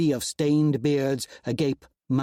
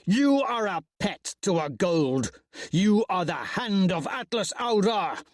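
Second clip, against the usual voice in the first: accusing roar; 6 semitones higher than usual. Acted. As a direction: hurl the accusation at him. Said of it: "I roar".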